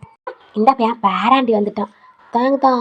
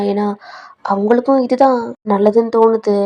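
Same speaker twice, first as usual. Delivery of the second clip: plain reading voice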